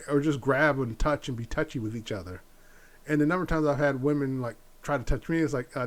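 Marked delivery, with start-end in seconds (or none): none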